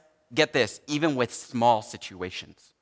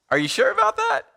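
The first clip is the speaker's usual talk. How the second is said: much higher, incredulous mock question; skeptical. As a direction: raise a doubting eyebrow with the voice. Pastor doubts colleague's answer.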